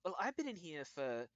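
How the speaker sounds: high, smiling gnome lady; freed gnome. Acted. GM plays the gnome.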